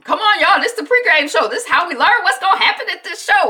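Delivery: loud, high, eager rallying; urging. Retold or imitated. Randall gets the party watching the pregame, urging. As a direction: imitate a host pleading with guests to tune in. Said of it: "trying to get the party into watching".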